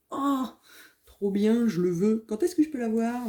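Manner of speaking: high-pitched, eager child's enthusiasm, mimicked; imitating excited son. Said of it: "Et il m'a dit". (imitation d'un fils tout excité, voix haut perchée)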